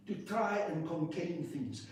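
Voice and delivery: ordinary talk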